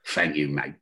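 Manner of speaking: low, mumbled thanks from the back seat; muttered London thanks; mock rocker voice. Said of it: "He went".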